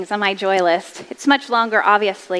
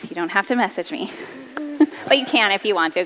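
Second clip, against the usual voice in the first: ordinary delivery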